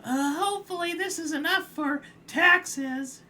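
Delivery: timid crab voice. Acted. Crab handing over kelp for taxes, high meek voice. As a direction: offer it up small and hopeful. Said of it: "my best impression that I can do of his voice".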